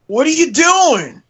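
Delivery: high, mildly annoyed question; mildly irritated. Retold or imitated. Ranger with a gun in his face, mildly irritated. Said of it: "Just mildly irritated".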